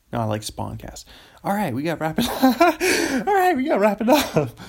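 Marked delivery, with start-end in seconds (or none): 2.5-3.2 sudden high loud laugh; burst of high laughter. Real, not acted. Cracks up at own words. 3.3-4.6 high, loud and fast through laughter; laughing. Real, not acted. Fun to say, though.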